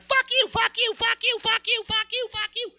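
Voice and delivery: very high squealed rapid-fire repeats; mock yelling match. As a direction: screech it back and forth like a silly dance-off. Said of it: "they start yelling".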